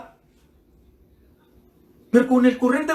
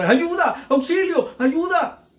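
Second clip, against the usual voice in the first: much higher mock desperate cry; old man crying out again in the night, higher mock yell; retold cry for help; 7 semitones higher than usual. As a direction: wail for help, pitched up like a frightened old man. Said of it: "volvió a gritar".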